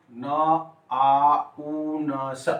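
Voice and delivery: न आ उ न स drawn out very slowly; sound-by-sound demo. Shown as a demonstration. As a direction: break the syllable into न, आ, उ, न, स. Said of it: "आप वॉइस देख लीजिए".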